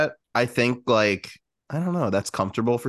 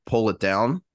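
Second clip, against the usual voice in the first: ordinary delivery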